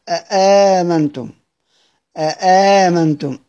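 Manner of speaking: slow أآمنتم with a clear hamza then long aa; demonstrated reading. Shown as a demonstration. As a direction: pronounce أآمنتم deliberately for the students. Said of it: "كيف يقرؤون هذه الكلمة". (نطق أآمنتم بتأنٍ كما يقرؤها حمزة)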